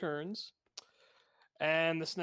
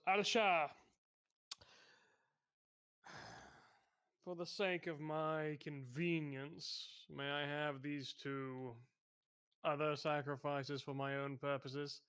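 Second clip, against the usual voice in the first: lightly voiced Manslayer; 5 dB quieter than usual.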